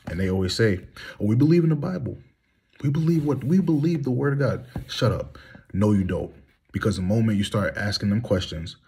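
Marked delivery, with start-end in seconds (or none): none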